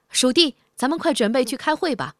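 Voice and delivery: eager call to go to the meeting; cat calling to the mouse. A narrator's reading. Just-woken cat urges the mouse to leave, eager call.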